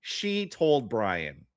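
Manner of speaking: slow verdict. Real, not acted.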